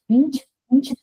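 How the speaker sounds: "nch, nch", the end of orange cut out; isolated palatal N. Shown as a demonstration. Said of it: "different sounds belonging to the same phoneme".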